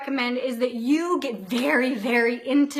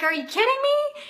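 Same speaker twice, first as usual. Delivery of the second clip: retold disbelief; 11 semitones higher than usual. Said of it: "I was like".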